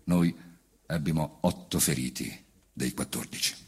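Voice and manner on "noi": normal talking voice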